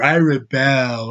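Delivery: slow, dead mock trailer line; mock lifeless drone. Mocking the trailer line again, slow lifeless drone. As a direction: drone it without life. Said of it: "some Brit ... that sounds".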